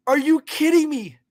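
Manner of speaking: usual speaking voice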